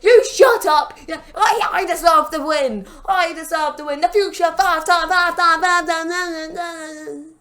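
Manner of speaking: loud, high-pitched mock tantrum; Booker T. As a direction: shout down the crowd in hammed-up outrage. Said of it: "But he's like".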